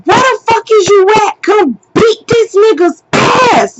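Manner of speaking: high, furious demand down the phone; going off. Retold or imitated. Woman calling her nephew to come fight, going off. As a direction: demand backup, fast then stretched out hard. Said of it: "I'm going off".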